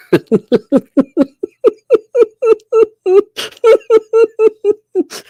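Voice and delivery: high, crying-laugh burst; shrieking laugh. Real, not acted. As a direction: cackle up high in disbelief.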